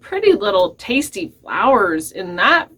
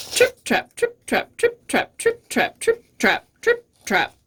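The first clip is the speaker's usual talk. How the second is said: crisp, staccato trip-trap; hoof steps; 13 semitones higher than usual. Acted. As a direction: clip each word like hooves on wood. Middle goat's hooves on the bridge.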